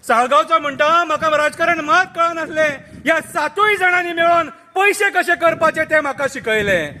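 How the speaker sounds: satirical poem recital. Acted. Reciting the turncoats' confession to friends, declaimed verse.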